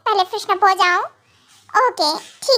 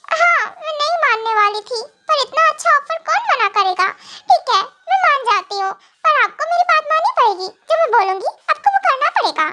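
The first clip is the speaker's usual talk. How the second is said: higher, teasing wife setting conditions; playful young wife; 7 semitones higher than usual. Acted. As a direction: bargain sweetly, laughing. (ऊँची, शरारती हँसती पत्नी)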